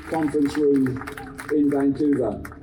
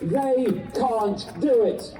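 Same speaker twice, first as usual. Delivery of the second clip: urgent activist; 6 semitones higher than usual. Acted. Rousing speech to crowd.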